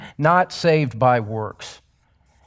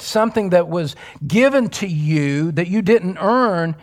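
ordinary talk, nothing marked

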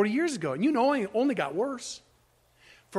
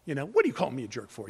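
playful banter; 7 semitones lower, faster than usual. Retold or imitated. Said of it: "calling people names out of love".

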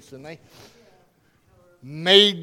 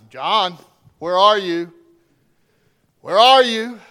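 calling out; 2 semitones higher, 11 dB louder than usual. Acted. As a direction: call his name and search for him loudly. God calling the preacher.

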